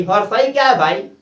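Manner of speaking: normal talking voice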